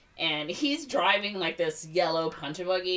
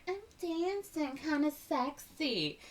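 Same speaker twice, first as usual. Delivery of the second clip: much higher, slow breathy-sounding tease; mock sexy voice; 11 semitones higher, 3 dB quieter, slower than usual. Mocking Ariel dancing to the boombox, high slow put-on sexy. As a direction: ham up a try-hard seductress.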